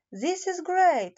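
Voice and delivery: lightly voiced young hedgehog's delight; excited. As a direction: let the joy burst out. Bobo delighted with presents.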